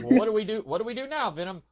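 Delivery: panicky Eddie. Retold or imitated. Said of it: "And then it's like".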